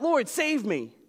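a high, pleading cry, "Lord, save me." sung out above the voice; cry for help. Retold or imitated. Peter sinking in the waves calls to Jesus, a high pleading cry. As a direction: beg to be rescued from sinking. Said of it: "he cried out".

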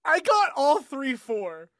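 high-pitched, real excitement at a win; excited surprise. Real, not acted. Player announces a lucky dice roll, excited. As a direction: exclaim it high, amazed by your own luck.

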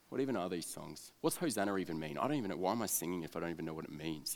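low, quiet grumbling; mock sulky outsider at the back; sullen loser. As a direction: mutter cynical complaints to yourself. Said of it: "real loser kind of guy".

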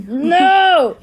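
playful shout. Pretend. Playful refusal.

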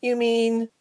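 high, small, wistful voice; mousy, longing tone. A narrator's reading. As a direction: start it hopefully, high and small. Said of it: "Mouse said in a wistful manner".

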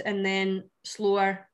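drawn out slowly. Shown as a demonstration. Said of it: "cheery and fast-paced".

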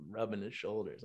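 normal talking voice

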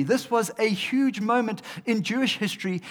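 usual speaking voice

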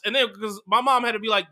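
ordinary talk

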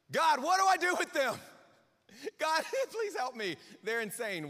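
a leader at his wits' end, venting; exasperated prayer. Retold or imitated. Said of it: "we've all had that prayer".

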